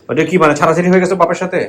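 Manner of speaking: loud, high question; to someone hard of hearing. Real, not acted. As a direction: raise the voice to be heard. আনো কম শোনেন.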